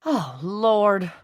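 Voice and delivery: groaned. A narrator's reading. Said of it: "Jenny groaned".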